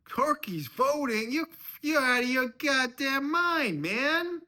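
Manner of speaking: high, screamed disbelief; incredulous outrage. Acted. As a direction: yell in furious disbelief, very high. Father-in-law's acted outrage.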